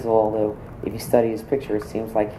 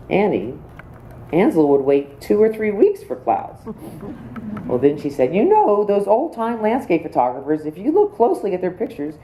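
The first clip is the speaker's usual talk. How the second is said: retold friend's advice in a higher voice; imitating Jean; 9 semitones higher than usual. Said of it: "Jean looked at me and said".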